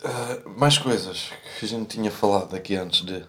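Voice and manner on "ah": usual speaking voice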